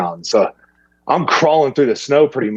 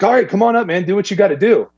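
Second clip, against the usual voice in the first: imitating the rancher, much higher; rancher giving the go; 8 semitones higher than usual. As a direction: wave them on in his voice. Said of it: "so he's like".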